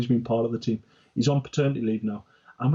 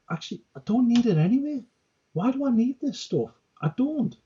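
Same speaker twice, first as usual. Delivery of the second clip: high-pitched inner voice; 11 semitones higher than usual. Retold or imitated.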